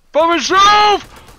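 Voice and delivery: high, loud, slow, stretched-out squeal; shrieked disbelief. Real, not acted. Streamer abandoned by his squad, squealing in disbelief. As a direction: wail it out like you've been abandoned. Left alone in a fight.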